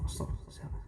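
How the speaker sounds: soft unvoiced whisper, startled. Worshipper whispering, disturbed by a phone, startled whisper. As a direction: hiss it under your breath mid-prayer.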